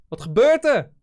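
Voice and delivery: loud, high-pitched Dutch exclamation; mock astonishment. Streamer lapsing into Dutch for the chat, mock astonishment.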